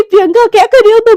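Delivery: high, loud and fast, shouting her name in shock; a startled roommate's loud shout. Acted. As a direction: yell out in alarm at the sight. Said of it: "इतना जोर से चिल्लाती है".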